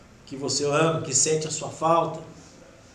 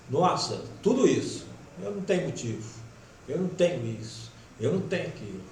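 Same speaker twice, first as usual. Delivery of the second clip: softer, lower, a weary imitated lament; sad complaint; 3 semitones lower, 8 dB quieter, faster than usual. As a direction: imitate a grieving relative complaining quietly. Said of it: "seu familiar falando aqui assim". (lamento triste e baixo)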